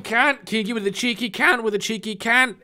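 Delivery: broad mock Australian, fast and bright; mock Australian accent. Joking about Australians, ordering in a mock Australian accent. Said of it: "I fucking love your Australian accent".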